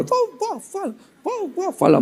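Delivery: very high, squeaky mock coaxing; baby-coaxing falsetto. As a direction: coax a baby in silly falsetto. Adults urging baby to talk.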